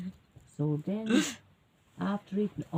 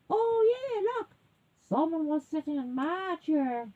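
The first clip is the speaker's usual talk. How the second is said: higher-pitched, mildly upset mother bear; mommy bear; 7 semitones higher than usual. A narrator's reading. Mommy bear finding her chair used, dismayed. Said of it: "And mommy says".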